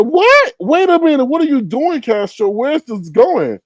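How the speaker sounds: high, startled questions; stunned, pitch jumping up; shocked high voice. Retold or imitated. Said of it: "And Shamar's like".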